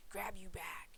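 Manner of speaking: unvoiced creeping whisper; demo whisper. Shown as a demonstration. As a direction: whisper it like the darkness pulling you back. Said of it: "Like this".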